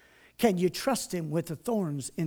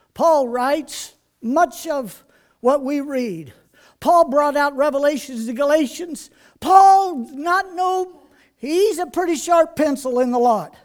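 loud, driving sermon voice; full-force preaching; 4 semitones higher, 10 dB louder than usual. Acted. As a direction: preach it hard to the congregation.

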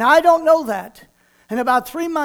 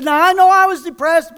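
excited testimony; 5 semitones higher, 7 dB louder than usual. Retold or imitated. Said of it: "He said".

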